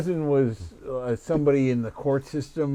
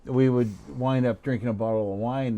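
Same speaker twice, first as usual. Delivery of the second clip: normal talking voice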